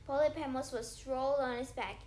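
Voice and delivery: ordinary delivery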